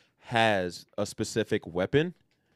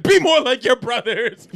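scolding Splinter; 14 semitones higher, 11 dB louder than usual. Retold or imitated. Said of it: "He was like".

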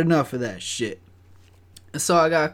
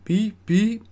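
high, louder "beep, beep"; imitated warning beep; 9 semitones higher, 5 dB louder than usual. Walking in, alerting everyone round the corner, imitated beep. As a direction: call out beeps to alert people. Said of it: "you go".

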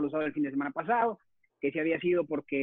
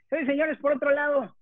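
ringmaster; 7 semitones higher, 3 dB louder than usual. Acted. Host introduces the next guest.

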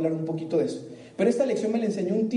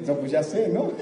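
inner squirrel voice; 4 semitones lower than usual. Retold or imitated. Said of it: "la ardilla de la gente habla así".

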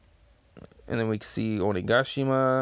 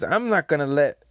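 Apu's voice; 4 semitones higher, 5 dB louder than usual. Acted. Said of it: "And he says".

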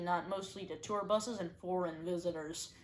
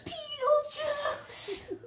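very high, very slow wailed name; high wailing summoning; much slower than usual. Pretend. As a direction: wail it out like a ghost.

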